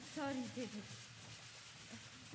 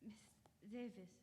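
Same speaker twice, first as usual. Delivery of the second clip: quiet sad news; 2 semitones lower, 19 dB quieter than usual. Acted. Doctor breaks news of death.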